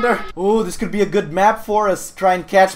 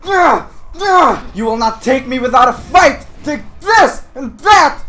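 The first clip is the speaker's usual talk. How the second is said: loud, high battle cries; hammed-up last-stand warrior shouting; mock heroic defiance; 6 semitones higher, 5 dB louder than usual. Gamer fighting off clan kids, mock heroic fury.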